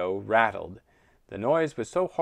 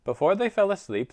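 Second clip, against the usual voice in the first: ordinary delivery, nothing marked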